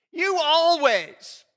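loud, high, slow accusing "You always"; accusing shout. Shown as a demonstration. Pastor demos a blaming spouse, loud accusing shout. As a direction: hurl "You always" like a charge. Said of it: "words we should always eliminate".

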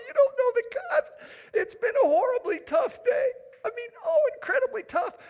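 high-pitched, hammed-up tearful wail; comically exaggerated crying complaint; mock sobbing wife. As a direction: wail through a list of woes like an overwrought wife. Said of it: "she immediately starts to sob uncontrollably".

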